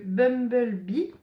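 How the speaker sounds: English name with a French accent; real French accent on English. Real, not acted. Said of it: "faites pas attention à mon accent anglais".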